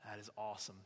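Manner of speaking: low, soft, impressed dude voice; hushed mock admiration; acted dude approval. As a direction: play a buddy quietly agreeing. Acted approval of idol builders.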